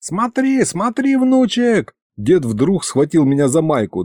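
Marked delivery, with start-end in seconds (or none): 0.0-1.9 high-pitched, eager grandpa; excited urging. Acted. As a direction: get your grandson to look, urgently. Grandpa grabs boy, points.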